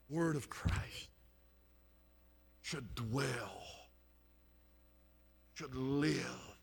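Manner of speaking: low, soft and slow, with long pauses; hushed pulpit earnestness; solemn hush. Real, not acted. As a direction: let each phrase sink in quietly. Preacher drops to solemn hush.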